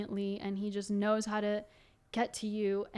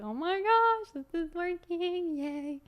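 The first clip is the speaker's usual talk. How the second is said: excited squeal; 8 semitones higher than usual. Retold or imitated. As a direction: relive the thrill of it finally working. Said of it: "So excited".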